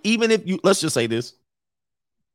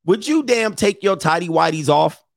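ordinary talk

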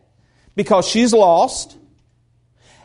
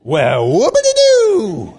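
sarcastic whoopity-doo; 3 dB louder, slower than usual. Acted. Mocking a boast in sermon.

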